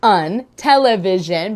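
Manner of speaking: "television" drawn out slowly; slow syllables. Shown as a demonstration. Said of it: "teach them to spell the syllables".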